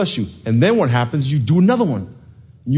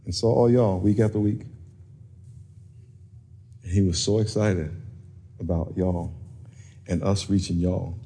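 softer, lower, heartfelt telling; hushed sincerity; 5 semitones lower, 7 dB quieter than usual. Real, not acted. Pastor telling congregation of a donor's faith in them, hushed and sincere. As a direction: bring it down low and quiet, sincerely.

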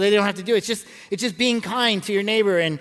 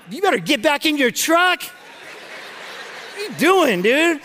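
mock angry retort; 6 semitones higher, slower than usual. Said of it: "I'm like".

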